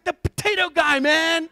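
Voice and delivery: loud, high mock fanboy; excited teller. Teller reminding man of his fame, mock excited. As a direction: imitate a thrilled dude insisting. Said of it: "he's so excited".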